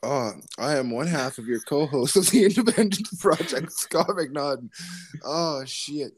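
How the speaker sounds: high, giggly voice after a laughing fit; after laughing hard. Real, not acted. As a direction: get through it still laughing. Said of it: "It's so funny".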